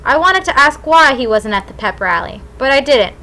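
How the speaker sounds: plain reading voice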